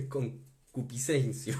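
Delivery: amused, word broken by real chuckles; joking, slowly through laughter; laughing. Real, not acted. Laughs at own made-up verb.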